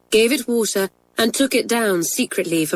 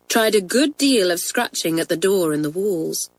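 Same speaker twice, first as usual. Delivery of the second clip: plain reading voice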